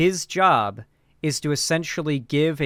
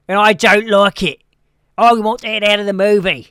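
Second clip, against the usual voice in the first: loud, higher Scottish rant; Critical Drinker impression; 6 semitones higher, 9 dB louder than usual. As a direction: bellow it like a grumpy Scottish reviewer. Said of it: "This is my Critical Drinker impression".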